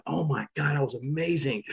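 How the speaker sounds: quiet, breathless awe; hushed "oh my God" after a great ride; awed hush. Retold or imitated. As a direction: marvel under your breath after the ride. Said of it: "I'm looking around like".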